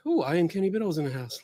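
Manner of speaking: ordinary talk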